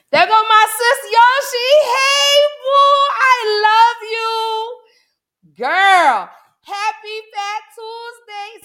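high, loud, drawn-out squeal of hello; stretched sing-song shout of love to a friend; excited greeting. Real, not acted. Spots friend Yoshi in chat.

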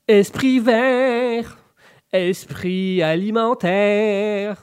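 slow, high, loud announcer slogan. Acted. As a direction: sell it like a TV ad tagline. Slogan for the bike table.

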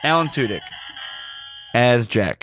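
loud promo sign-off voice; podcast outro. Acted. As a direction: announce it like an ad read.

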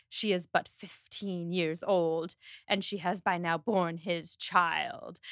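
slow, over-solemn mock storyteller; super melodramatic. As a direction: draw out each word with hammed-up gravity. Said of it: "It is super melodramatic".

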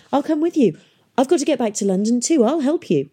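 put-on high, quick, eager boy; eager offer. A narrator's reading. Boy hurrying beside girl offers help, eager. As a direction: offer help in an urgent rush.

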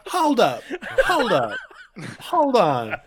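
high, sharply falling objections; indignant protest. Acted. Pilot objecting to the crew's jab, indignant. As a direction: cut in indignantly, pitch swooping down.

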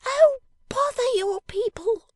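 very high, loud, drawn-out dismissal; scornful interruption. Acted. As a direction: cut in high and impatient. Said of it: "interposed Miss Wren with a toss of her head".

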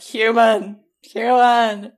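loud mock yell of a demanding cat; cat shouting at its humans to be let out, loud scraggly yell. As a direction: yell it like a bossy cat at the door. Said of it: "Korra's scraggly yell".

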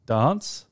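dance said "dahnce"; dance with long ah. Shown as a demonstration. Telling co-host their own version, dance as "dahnce". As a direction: open the vowel of dance to "ah". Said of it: "Okay, so I'm dance".

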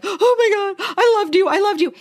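high-pitched hammed-up sobbing fangirl; gushing fake blubbering; mock crying. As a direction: wail it out in pretend tears, way up high. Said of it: "gonna be the one crying".

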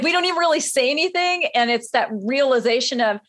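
usual speaking voice

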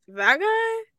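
high, baffled "that guy?"; incredulous. Retold or imitated. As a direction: sneer it like a confused fan. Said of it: "So they're gonna be like".

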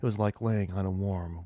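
ordinary talk

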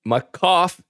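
attempted Jersey accent on "cough-". Host trying to talk like Jersey boys, Jersey accent. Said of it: "Are we gonna talk like Jersey boys?".

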